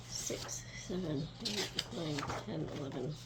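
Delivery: quiet counting, trailing off; counting under breath. Real, not acted. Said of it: "Hold on, I'm counting".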